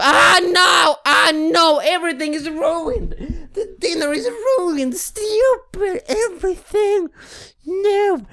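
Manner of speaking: high anguished game wail; anguished shouting. Real, not acted. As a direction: shriek in mock despair at the ruined dinner. Dinner ruined in game.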